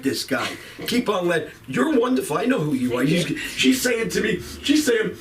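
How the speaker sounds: ordinary talk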